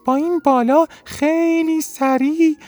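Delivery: a tiny winged snail speaking up proudly. Acted. (صدای خیلی زیر و نازک یک حلزون بالدار)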